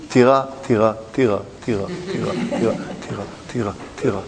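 low repeated word, dwindling to very soft; fading repetition. Acted. Someone who goes on for weeks about a castle, repetition fading away. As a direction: keep repeating it, sinking away.

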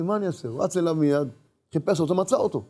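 ordinary talk, nothing marked

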